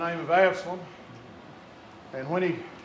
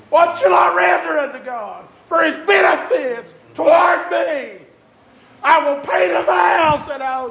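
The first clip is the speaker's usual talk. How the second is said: preaching at full force; 8 semitones higher, 7 dB louder than usual. Acted. Preacher shouting hope to the congregation, full force. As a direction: build to a roaring preacher's cry. Shouted psalm quote in sermon.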